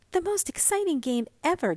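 put-on high, quick girlish voice, lightly gasping; a little girl bursting with excitement; breathless girl. A narrator's reading. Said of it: "gasped Mink".

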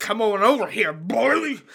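high-pitched, put-on crazy character. Shown as a demonstration. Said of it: "show you guys the crazy".

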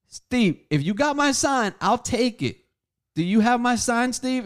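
loud, high demand for the sign; demanding his sign back from Steve, loud and high; retold demand. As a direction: press him hard for it.

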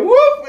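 very high squeaky whoop; mock whoop. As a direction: yelp it like a startled girl. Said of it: "She went".

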